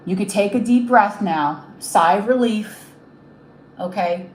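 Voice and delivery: moved while giving heartfelt reassurance; emotional. Real, not acted. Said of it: "I'm getting very emotional right now".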